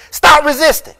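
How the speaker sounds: high, loud barked command of an officer; shouted police order. Acted. Officer yelling at the man he pins down, loud barked order. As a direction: snap it like a cop pinning someone down.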